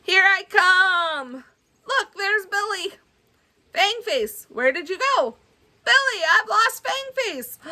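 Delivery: put-on excited young hero shouting for a friend; high excited kid voice. Children's story character calls to Billy, high and excited. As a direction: lift it up high, calling out eagerly.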